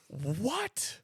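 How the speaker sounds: high-pitched incredulous yelp; baffled, high "What?"; stunned. Acted. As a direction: react in mock disbelief. Acts out his stunned reaction.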